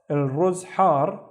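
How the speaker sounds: slow, clear pronunciation. Shown as a demonstration. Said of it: "teacher models the sentence".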